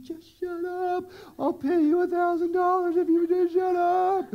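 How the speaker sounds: put-on high, pleading plea to a screaming baby; desperate tired parent. Sleep-deprived parent begging crying baby to hush, high pleading.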